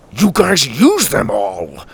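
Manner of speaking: huffy grandpa, slow and put out. A narrator's reading. Grandpa to the twins about his pretzels, huffy. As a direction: huff it slowly, mildly indignant. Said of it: "Grandpa huffed".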